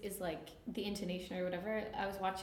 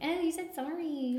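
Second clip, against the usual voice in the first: high, slow teasing call; teasing mimicry; 8 semitones higher, 4 dB louder than usual. People teasing a Canadian over her pronunciation, teasing mimicry. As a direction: draw it out to poke fun.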